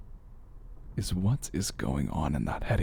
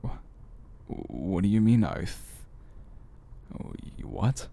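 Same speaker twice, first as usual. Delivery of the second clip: halting, stammered disbelief; taken aback. Acted. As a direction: stumble over a startled question, breaking off mid-word. Taken aback by listener's unheard reply.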